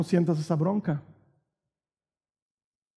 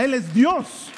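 ordinary talk, nothing marked

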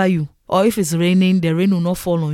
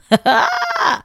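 very high burst of laughter; shrieking laugh. Real, not acted. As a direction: shriek with delight. Own bicycle joy.

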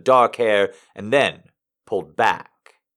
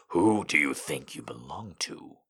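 a low, cold whisper, much softer; 9 semitones lower, 12 dB quieter than usual. A narrator's reading. Don quietly asks his bound pet who owns her, cold whisper. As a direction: hiss the question quietly and coldly. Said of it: "he asked coldly".

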